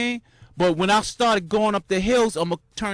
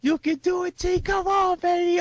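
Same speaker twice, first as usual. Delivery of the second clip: rising, higher self encouragement; self pep talk; 9 semitones higher than usual. Real, not acted. Runner cheering himself on in the last miles, high and excited. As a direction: pump yourself up to finish. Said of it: "I'm saying this to myself".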